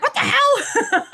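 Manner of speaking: slow, high, drawn-out what the hell through laughter; imitating grandpa's stunned outburst while laughing; laughing disbelief. Grandfather stunned by fruit roll-up, said high and slow through laughter.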